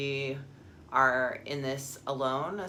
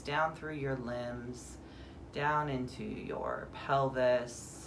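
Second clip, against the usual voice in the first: guided meditation; 2 semitones lower than usual. Acted. Guide grounds listeners from head to earth, calm meditation voice. Leads a guided body scan.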